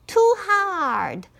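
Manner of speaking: put-on high, fussy girl voice; girl complaining. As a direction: reject it like a picky child. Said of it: "she said".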